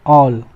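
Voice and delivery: ऑल with a rounded "aw"; low, rounded "awl" for ऑल; rounded vowel. Shown as a demonstration. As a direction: drop low on ऑल and round it toward "o". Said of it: "आ वाला sound लिया जाएगा।".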